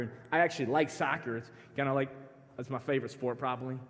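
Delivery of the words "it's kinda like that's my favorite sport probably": ordinary talk, nothing marked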